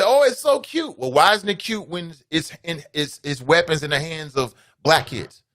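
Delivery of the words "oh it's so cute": mock gush. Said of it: "And people say".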